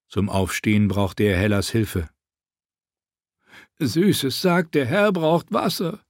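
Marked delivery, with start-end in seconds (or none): 3.8-6.0 higher, wheedling plea; begging tone. A narrator's reading. Tyrion begs the soldiers in his best wheedling tone. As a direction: beg sweetly. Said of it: "in seinem besten Bettelton".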